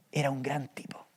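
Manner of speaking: susurrado, casi sin voz; díselo en secreto a la congregación; susurro. Acted. Confidencia sobre Elías. (susurro confidencial)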